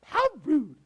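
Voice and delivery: sassy catchphrase to close the bit; Stephanie Tanner. Retold or imitated. Pastor's Bob Saget tribute, light Stephanie Tanner impression. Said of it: "That's good old Stephanie Tanner".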